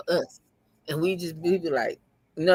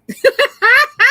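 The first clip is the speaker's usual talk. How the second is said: loud laugh; 12 dB louder than usual. Real, not acted. Laughing at Monica the thug.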